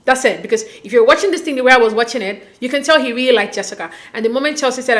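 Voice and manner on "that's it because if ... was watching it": ordinary talk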